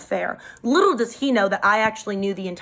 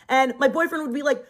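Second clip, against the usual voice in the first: ordinary talk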